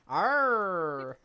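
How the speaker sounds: loud hammy pirate growl. Shown as a demonstration. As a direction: bellow it like a stage pirate. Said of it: "say shard in a pirate voice".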